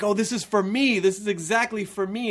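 a touch of personal delight; delighted discovery. Retold or imitated. Fan recalling first seeing the show, mildly delighted.

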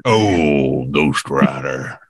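low, slow grizzled voice; old prospector. Acted. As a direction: drag it out like an old cowboy. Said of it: "my old prospector".

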